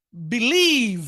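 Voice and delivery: বিলিভ with a long, drawn "leee"; drawn-out vowel. Shown as a demonstration. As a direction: stretch the second syllable of বিলিভ, long "ee". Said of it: "টান হবে".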